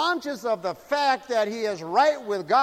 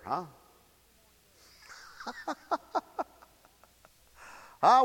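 normal talking voice

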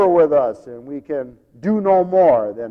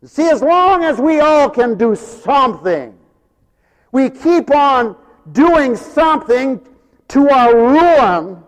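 raised, drawn-out preacher's cry; forceful preaching; 8 semitones higher, 7 dB louder than usual. Real, not acted. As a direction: preach it loud and high, stretching the key words.

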